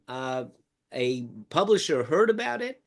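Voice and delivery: usual speaking voice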